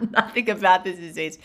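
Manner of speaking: laughing through the words. Real, not acted. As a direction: echo the line while still laughing. Echoing partner while laughing.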